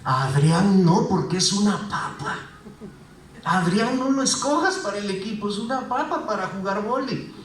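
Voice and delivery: lightly voiced gossip among the guys; mock gossip about a teammate; gossiping murmurs. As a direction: grumble it as a sneaky aside, half-acted. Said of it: "los murmullos, el chismorreo que dicen".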